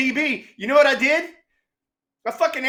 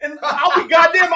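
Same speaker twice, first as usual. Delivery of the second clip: ordinary talk, nothing marked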